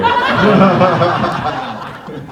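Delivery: loud burst of laughter; big real laugh. Real, not acted. Panel laughing at joke.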